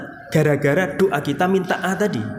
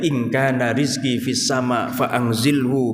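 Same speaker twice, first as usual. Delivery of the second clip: prayer recitation; 6 semitones lower than usual. Acted.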